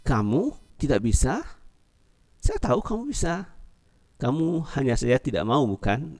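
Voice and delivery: high, teasing disbelief; high, teasing reply in Indonesian; doubting Lina. Acted. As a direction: needle the friend kindly. The reply translated in character.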